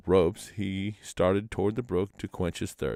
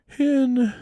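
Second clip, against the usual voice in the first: high, louder shivering cry, drawn out; a cold, miserable whimper; shivering murmur; 6 dB louder than usual. A narrator's reading. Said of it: "murmured Manston".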